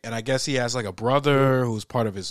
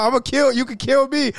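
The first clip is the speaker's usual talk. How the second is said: higher, louder crazed dare; crazed taunt; 12 semitones higher, 6 dB louder than usual. Acted. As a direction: taunt like a madman. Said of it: "Tell me, like".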